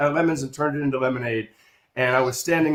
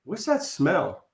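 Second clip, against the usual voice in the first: slow, softer puzzled question; puzzled girl; 3 semitones higher, 5 dB quieter, slower than usual. Retold or imitated. Eighth grade girl beside the narrator, puzzled slow question. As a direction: sniff out the question slowly, in a girl's voice. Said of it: "she says".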